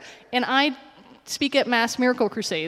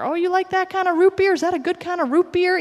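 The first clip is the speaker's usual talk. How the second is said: bright chit-chat; 9 semitones higher, 5 dB louder than usual. Retold or imitated. Chatting up lady in store.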